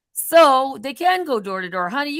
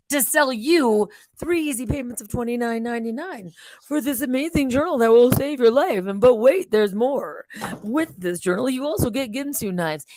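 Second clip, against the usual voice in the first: infomercial pitch. Retold or imitated. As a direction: sell it like a late-night ad host. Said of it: "When I did infomercials".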